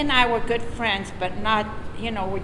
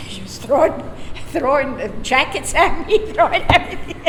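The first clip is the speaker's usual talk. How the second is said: laughing through the words; breaking into laughter while telling; 6 semitones higher, 3 dB louder than usual. Real, not acted. Woman recalling her mother throwing jackets, laughing as she tells.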